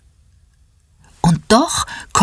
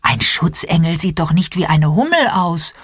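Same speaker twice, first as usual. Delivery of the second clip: ordinary delivery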